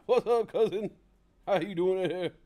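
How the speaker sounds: loud, high, booming comic greeting; Bernie Mac. Retold or imitated. Greeting the audience as Bernie Mac, loud and booming. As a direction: throw the greeting out big like a stand-up host. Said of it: "Bernie Mac".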